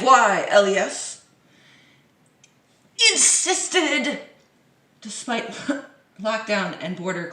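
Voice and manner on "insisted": insisted raised high and louder, sarcastic; mocking emphasis. Vlogger mocking the Health Minister, sarcastic. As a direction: sneer the word insisted.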